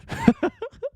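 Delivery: high-pitched giggle; laugh. Real, not acted. Host laughing at his own prediction, high giggle. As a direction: burst into a high, quick laugh.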